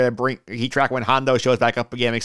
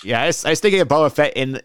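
normal talking voice

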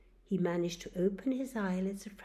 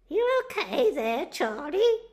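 higher, louder neighbour calling over a fence; 10 semitones higher, 11 dB louder than usual. Acted. Neighbour Bill checks on Charlie over the fence, calling out. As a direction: call over the fence to a friend.

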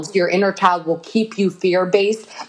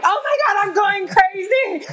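much higher, shrill-free frantic cry; hammed-up panic shout; panicked mock cry; 13 semitones higher than usual. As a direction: freak out as if hearing voices. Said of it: "you'd be like".

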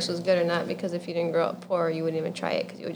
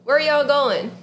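quoted driver; 5 semitones higher, 6 dB louder than usual. Retold or imitated. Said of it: "the first thing she says".

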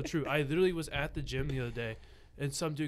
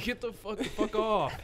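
high, loud exasperated brush-off; mock outraged dismissal; 10 semitones higher, 7 dB louder than usual. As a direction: wave them away with hammed-up outrage. Acted rejection of an entitled request.

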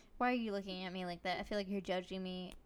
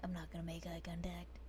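a softer, whispered aside while looking away; 3 semitones lower, 9 dB quieter than usual. Real, not acted. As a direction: mutter it under the breath so he won't hear. Joking aside about rock talk.

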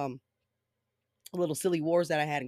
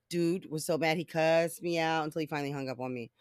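normal talking voice

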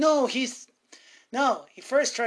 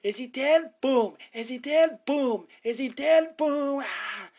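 rising, louder yells with each boom; hammed-up shout that keeps climbing; mock frantic shouting; 4 semitones higher, 5 dB louder than usual. Barbara shouting at Judith while firing, louder each time. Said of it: "she's shouting at Judith".

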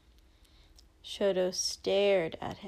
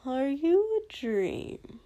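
dazed villain; 4 semitones higher, slower than usual. Acted. Dazed villain asks if dreaming.

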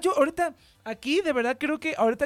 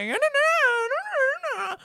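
much higher and very slow mock barrio drawl; mock barrio guy; slower than usual. Mocking hard-to-understand barrio guys, high slow drawl. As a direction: stretch out each no like a street guy you can't understand. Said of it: "si te encuentras unos cuates que también es como que".

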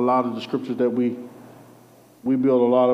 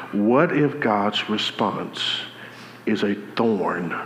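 very slow, lower, word by word; emphatic repeat; 4 semitones lower, much slower than usual. Real, not acted. Preacher repeating the question for those who missed it, slow emphatic delivery. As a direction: spell it out slowly and deliberately. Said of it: "I'm gonna say it again 'cause I don't think y'all heard me".

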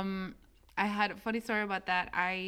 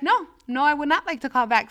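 raised, loud and sharp refusal; with attitude; 5 semitones higher, 8 dB louder than usual. Retold or imitated. Caller refusing the agent's offer, with attitude. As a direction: push back with real attitude. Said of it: "So then I got an attitude".

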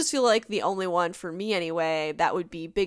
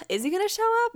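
high wondering; 14 semitones higher, faster than usual. Retold or imitated. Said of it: "I'm kinda like".

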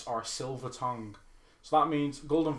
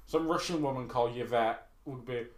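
ordinary talk

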